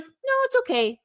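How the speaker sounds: squeaky high "no, it's okay"; high mock reassurance. Mocking the League's excuse, high and reassuring. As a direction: pitch it way up, mock soothing. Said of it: "was like".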